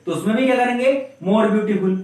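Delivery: ordinary talk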